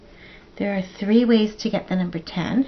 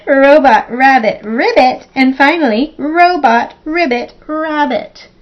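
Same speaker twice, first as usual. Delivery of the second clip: higher, louder, emphatic word list; tongue-twister attempt; 5 semitones higher, 7 dB louder than usual. Shown as a demonstration. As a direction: punch out each look-alike word without tripping. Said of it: "I'm going to try".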